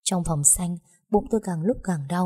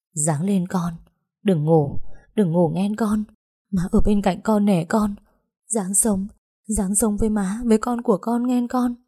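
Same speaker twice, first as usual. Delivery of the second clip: tearful pleading; 3 semitones higher than usual. Acted. Mother begs dying daughter.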